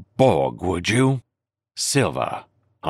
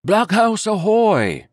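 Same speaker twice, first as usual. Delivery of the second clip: loud, high, drawn-out call across the wood; hearty hail; 11 semitones higher, 4 dB louder, slower than usual. Acted. Doctor hailing the sleeping blockhouse from the wood, loud hearty call. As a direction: hail them from afar, big and hearty. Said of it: "it cried".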